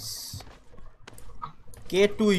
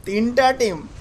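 excited; 8 semitones higher, 6 dB louder, much slower than usual. Real, not acted. Repeating alarm about teams.

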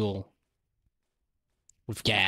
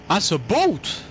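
excited; 5 semitones higher, slower than usual. Real, not acted. Spotting the boat, repeated.

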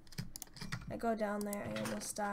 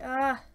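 a sudden loud yelp of fright; startled yelp; 12 dB louder than usual. Real, not acted. Player startled by a monster, yelping. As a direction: jump and yelp.

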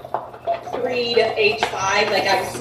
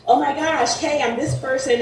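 mock excitement, pitched up; higher, lightly acted enthusiasm; eager guest; 6 semitones higher than usual. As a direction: imitate someone keen to come on the show. Voices another eager would-be guest.

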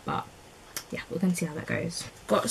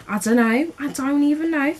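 higher, louder baffled repeat; high-pitched bafflement; 9 semitones higher, 7 dB louder than usual. Real, not acted. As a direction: raise the pitch, throwing up your hands. Repeats her bafflement in raised voice.

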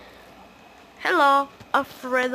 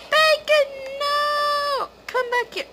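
high, loud panicked yell; shouting after him; 5 dB louder, slower than usual. Real, not acted. Gamer yelling at her teammate Bacon as he runs off, loud. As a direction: yell for him to come back.

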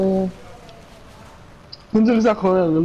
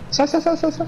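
panicked high calls of sai; urgent high yelling; 9 semitones higher than usual. Real, not acted. Urgent shout during chase.

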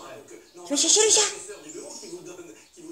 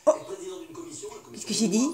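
ordinary talk

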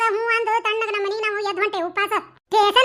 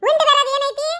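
higher, offended retort; indignant echo; 5 semitones higher than usual. Acted. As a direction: throw his words back at him. Wife throws his words back. (ಸಿಡುಕಿನಿಂದ ಮರುನುಡಿಯುವುದು)

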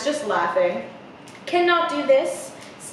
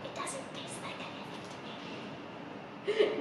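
very soft, breath-only whisper; hushed playful whisper; whispered aside; 8 semitones higher, 16 dB quieter, faster than usual. Pretend. Aside as tongue tastes air.

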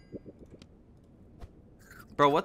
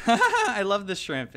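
slow and louder, laughing through it; 5 semitones higher, 6 dB louder, slower than usual. Real, not acted. Gamer gushing about his favorite shrimp pet, laughing. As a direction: gush it with a giggle.

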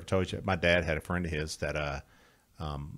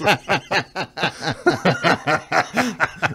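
high, loud laughter that keeps rolling; long loud laugh; 9 semitones higher, 9 dB louder than usual. Real, not acted. Guest cracking up at his own dog-food story, long laugh.

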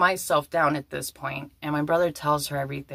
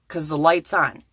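usual speaking voice

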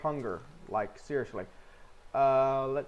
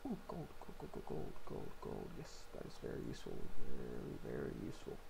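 whispering; 14 semitones lower, 7 dB quieter, faster than usual. Real, not acted. Excited find while mining quietly.

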